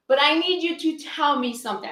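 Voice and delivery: usual speaking voice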